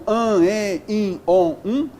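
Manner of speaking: "an, en, in, on, um" as a list of nasal vowels; nasal vowel sounds. Shown as a demonstration. Teacher recalling nasal vowels, "an, en, in, on, um" sounded one by one. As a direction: give "an, en, in, on, um" separately, each nasal. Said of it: "É o som".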